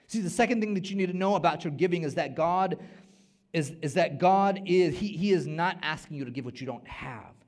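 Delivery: loud, high, emphatic; preacher hammering the message; full-force preaching. Acted. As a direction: proclaim the second point.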